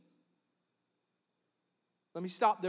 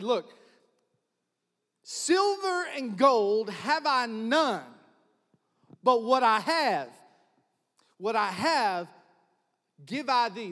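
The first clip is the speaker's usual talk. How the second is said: King James recital; 2 semitones higher, 6 dB louder, slower than usual. Acted. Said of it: "That's the KJV".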